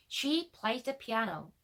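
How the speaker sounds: slow, careful English model sentence; model for repeating. Shown as a demonstration. Teacher giving a sentence for learners to repeat, slow model reading. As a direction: say it slowly for learners to copy. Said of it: "제가 한 문장씩 말해볼 테니까 여러분이 따라하는".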